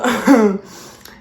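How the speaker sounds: a big real laugh bursting out; loud burst of laughter. Real, not acted. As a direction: laugh loudly at the memory. Laughs telling the story.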